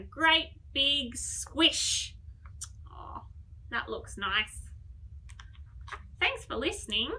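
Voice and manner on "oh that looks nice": ordinary delivery, nothing marked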